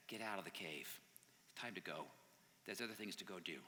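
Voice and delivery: low, hushed gentle urging; soft encouraging voice. Acted. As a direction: coax gently, very softly. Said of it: "This really soft voice comes up".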